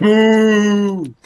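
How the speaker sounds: long, high, loud boo; mock booing. Friend heckling a bad impression, mock jeering boo. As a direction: boo them off the stage.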